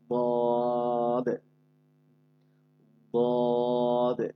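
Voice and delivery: very slow বদে with the tongue side pressed; drawn-out dad. Shown as a demonstration. Teacher shows students the dad sound. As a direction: stretch বদে slowly from the tongue edge. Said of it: "এভাবে, হ্যাঁ".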